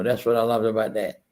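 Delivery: ordinary talk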